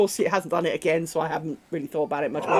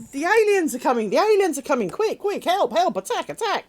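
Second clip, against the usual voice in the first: higher, louder hammed-up panic; alien alarm; 9 semitones higher, 4 dB louder than usual. The brother dog trying to scare Amy off her spot, hammed-up alarm. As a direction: shout the alarm like a cartoon invasion. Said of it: "he did the whole".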